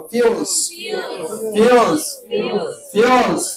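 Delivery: ফিউলস as "fyools", three times with pauses; English pronunciation drill. Shown as a demonstration. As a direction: drill ফিউলস, each with a fyoo glide and fall. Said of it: "তিনবার করে বলো". (ফিউলস, ইংরেজি উচ্চারণে থেমে থেমে তিনবার)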